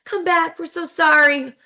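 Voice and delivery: high mock apology; hammed-up pleading call; chorus of women pleading. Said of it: "And then all the girls".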